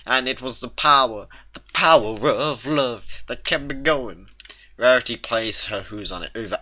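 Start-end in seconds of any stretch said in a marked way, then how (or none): 0.0-4.3 higher, overwrought lovesick declaration; melodramatic exclamation. A narrator's reading. Applejack reunited with Rarity, melodramatic declaration. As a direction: pour out your longing like a stage lover. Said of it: "Applejack exclaimed, nearly collapsing".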